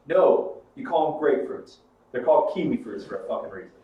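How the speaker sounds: loud, emphatic imitated correction; mock exasperation. New Zealander friend correcting someone on kiwi fruit, mock exasperated. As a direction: lay down the law as the annoyed friend.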